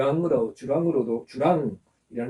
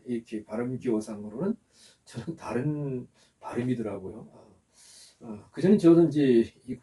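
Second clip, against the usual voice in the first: ordinary talk, nothing marked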